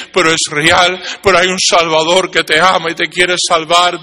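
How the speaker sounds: forceful, sustained evangelistic appeal; urgent preaching. Real, not acted. Preacher urging the unsaved to accept salvation today, urgent preaching. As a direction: drive each phrase home to the listener.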